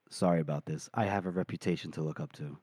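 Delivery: low, hushed aside; acted secret, gentle apology; confidential apology. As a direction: drop way down and murmur it privately. Confidential aside after yelling, hand on shoulder.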